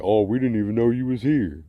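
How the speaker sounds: loud, high mock innocence; mocking fake surprise. Mocking staff who pretend not to see him, loud and snide. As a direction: sneer their pretend ignorance back at them. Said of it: "these motherfuckers act like".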